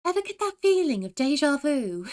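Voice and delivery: playful British voice line; fake British accent. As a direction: chirp it in a put-on British accent. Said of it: "do a fake British accent".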